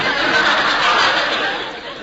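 big burst of laughter; hearty laugh. Acted. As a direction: laugh out loud at the joke. Laughs at Hubert's joke.

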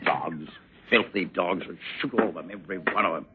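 low, soft contempt; quiet, bitter muttering to yourself; muttered curse. Acted. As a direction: curse them under your breath. Mutters curses once servant has gone.

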